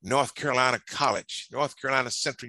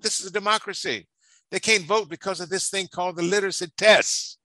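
higher and louder, pressing the point to the president; impassioned plea; 6 semitones higher, 3 dB louder than usual. Retold or imitated. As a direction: argue it hard, voice raised. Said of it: "King said to President Johnson".

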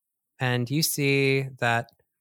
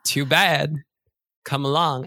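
louder, lightly voiced scheming cat; sly bossy cat; 3 dB louder than usual. Cat luring the new kid on a journey, louder sly voice. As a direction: coax then order, a touch smug. Cat leads Cecil away.

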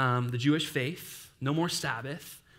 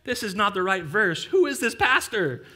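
mock confusion; 5 semitones higher, 5 dB louder than usual. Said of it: "those who are like".

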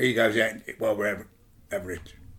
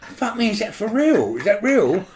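disbelief; 8 semitones higher than usual. Retold or imitated. Disbelief mid-fight.

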